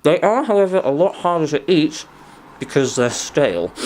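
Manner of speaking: talking through a mouthful of donut; chewing while speaking; mouth full. Real, not acted. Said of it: "I'm talking with my mouth full".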